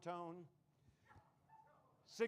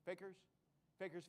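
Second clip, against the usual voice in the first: ordinary talk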